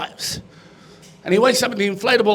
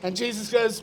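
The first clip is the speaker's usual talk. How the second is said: ordinary talk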